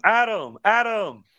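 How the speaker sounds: mock Chuck calling Adam across the set. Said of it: "and Chuck's going".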